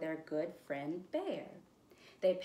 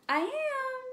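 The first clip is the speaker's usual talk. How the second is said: high shout of "I am"; 4 dB louder than usual. Shown as a demonstration. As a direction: call it out joyfully.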